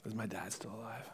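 almost too moved to speak; hushed. Acted. Joseph revealing himself to his brothers, hushed.

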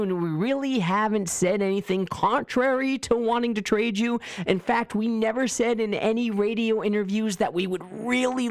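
hammed-up insincere sales pitch; mock front office pitch. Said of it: "That's just saying, like".

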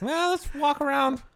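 high, slow goofy voice; mocking imitation. Friend mocking how they just walk around, high and goofy. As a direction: mimic them in a drawn-out, high-pitched voice. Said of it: "They just like".

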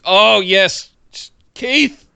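gushing fan. Real, not acted. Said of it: "fan gushing".

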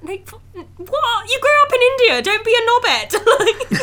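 high, loud, laughing mock rant; incredulous laughing put-down; exasperated outburst. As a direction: blurt it out in disbelief through laughter. Mocking character's American accent.

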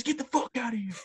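imitating a coach screaming get out; mock coach yell. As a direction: throw him out in a high mock yell. Coach yells at player.

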